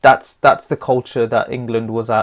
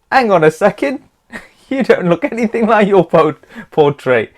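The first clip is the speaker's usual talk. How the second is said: higher, louder mock-indignant king; Henry dismayed; 7 semitones higher, 4 dB louder than usual. Henry meets Anne of Cleves, high mock indignation. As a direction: act the king's shocked double take. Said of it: "uh, he said".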